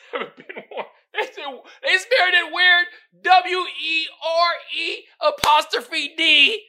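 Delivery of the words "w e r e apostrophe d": loud, slow, letter-by-letter spelling; incredulous spelling. Real, not acted. Host reading the meme's spelling in loud disbelief.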